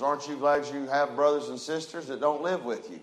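ordinary talk

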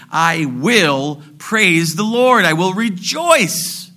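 slow, emphatic preacher's vow; preaching declaration. Acted. Preacher voicing the believer's resolve to praise, slow and ringing.

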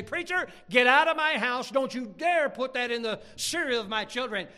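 loud, high scolding; acted protective fury; outraged mother. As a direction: throw him out indignantly. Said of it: "every mom in this room here would say".

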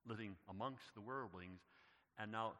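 ordinary delivery

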